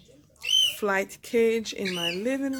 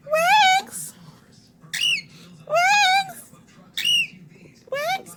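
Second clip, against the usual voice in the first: squeaky calling out; 5 dB louder, much slower than usual. Real, not acted. Calling out to the parrot.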